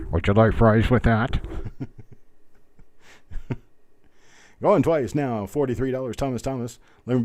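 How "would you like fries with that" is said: loud, slow tinny intercom voice; drive-through speaker. Retold or imitated. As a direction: talk like a fast-food drive-through box.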